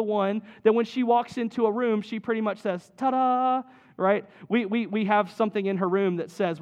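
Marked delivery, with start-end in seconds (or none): 3.0-3.6 higher and louder, bright announcing Ta-da; showy ta-da. Retold or imitated. Little Kelsey walking into a room, higher and louder announcing. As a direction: present yourself like a star. Daughter voiced entering a room.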